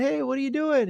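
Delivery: high upbeat hey; cheery greeting. Retold or imitated.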